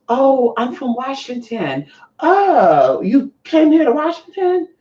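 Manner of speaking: high, drawn-out chatty voice; acted small talk. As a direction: play a stranger's slow, put-on politeness. Acts small talk with the guy.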